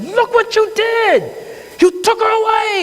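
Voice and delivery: a grieving brother shouting across the courtroom; angry outburst. Retold or imitated. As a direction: hurl it at the defendant in grief.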